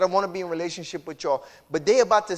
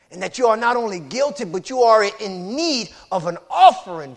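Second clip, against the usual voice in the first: preaching at full force; 10 dB louder than usual. Acted. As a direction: shout the call to the congregation. Preaching builds to shouting.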